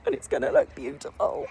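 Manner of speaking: high squealing laughter; can't stop laughing, really; laughing fit. Real, not acted. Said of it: "I can't stop laughing now".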